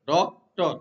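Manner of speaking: Doctor split in two, weight on ডক; stress on first syllable. Shown as a demonstration. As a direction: hit the ডক in doctor, then let টর drop. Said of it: "তাহলে ডকটা হচ্ছে stress".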